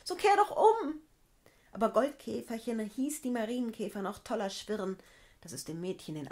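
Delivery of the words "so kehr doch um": high, loud, desperate cry; terrified girl shouting in the storm ride; frightened shout. A narrator's reading. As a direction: scream at the beetle to stop. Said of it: "rief das Mädchen".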